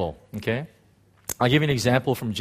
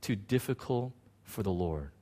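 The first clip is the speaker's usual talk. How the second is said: gentle rebuke; 8 dB quieter than usual. Retold or imitated. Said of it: "He says".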